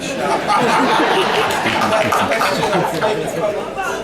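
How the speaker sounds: a big burst of real laughter; loud laugh. Real, not acted. Host bursts out laughing at the loud voice remark.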